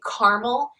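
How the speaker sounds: caramel imitated as "CAR-mel"; two-syllable caramel. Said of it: "hear somebody say caramel".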